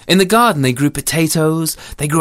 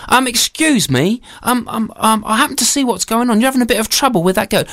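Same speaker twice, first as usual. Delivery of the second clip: higher-pitched, hesitant opening, then quick; helpful soldier; 7 semitones higher, faster than usual. Acted. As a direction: politely butt in on someone's trouble. Storyteller voices the soldier.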